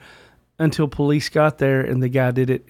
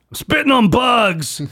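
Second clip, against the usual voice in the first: a hint of a dopey clone; 8 semitones higher than usual. Dim clone voiced in story.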